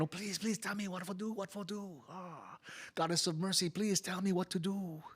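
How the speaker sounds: soft, high, hushed plea to the goddess; pleading prayer. Acted. Immigrant prays to Kuan Yin, soft and pleading. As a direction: beg for guidance under your breath.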